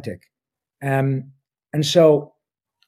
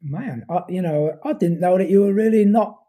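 higher, lively British accent imitating British fans; 7 semitones higher, 4 dB louder than usual. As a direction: play a British fan, chatty and amused. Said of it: "fans from across the pond who have said".

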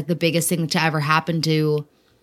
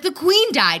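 urgent news; 11 semitones higher than usual. Retold or imitated. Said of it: "And I was like".

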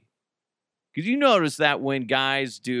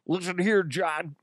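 mouth-full sportscaster impression; John Madden; 6 semitones higher than usual. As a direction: talk as if round a sandwich. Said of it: "sounds like he has a sandwich".

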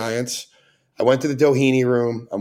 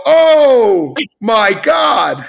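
loud, very slow, higher mortified exclamation; shocked gasp; 10 semitones higher, 7 dB louder, much slower than usual. Retold or imitated. Drunk fan realizing he misnamed Al Pacino, loud and drawn out. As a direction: stretch it out in horror.

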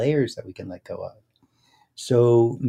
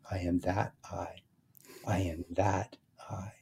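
hushed, slow, leaning on "that" then a separate "I"; soft mantra with weight on "that"; stress on a word; 2 semitones lower, 11 dB quieter, slower than usual. Shown as a demonstration. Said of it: "go to the emphasis of the words".

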